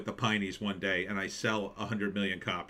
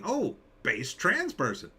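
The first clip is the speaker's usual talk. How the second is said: pleased surprise; 7 semitones higher than usual. Real, not acted. Reacting to headline.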